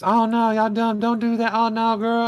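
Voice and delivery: high mock dismay; hammed-up high-pitched heckling; woman heckler. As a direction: heckle the screen. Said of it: "And she's just".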